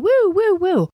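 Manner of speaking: high siren woo; siren noise. Retold or imitated. Mimicking the hat for the dare, high woo. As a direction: mimic the hat light wailing. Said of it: "with your hat going".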